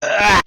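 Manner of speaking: sudden loud yelp. Acted. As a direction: blurt out a sharp cry. Shout at start.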